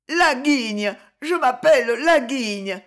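high, loud wailed confession; sobbing. A narrator's reading. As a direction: wail it out as a beaten man. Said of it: "sanglota Cogolin". (aveu sangloté, voix haute)

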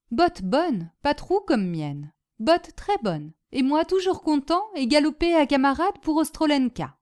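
lightly voiced foreigner in broken French; Boginski, cheerful Polish storyteller. Polish guest telling his hostess of boots and battle, cheerful. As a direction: tell your war tale eagerly, phrase by phrase.

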